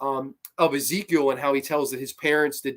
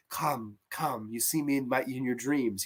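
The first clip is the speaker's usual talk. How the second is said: retold beckoning; 7 dB quieter than usual. Acts the dream woman beckoning.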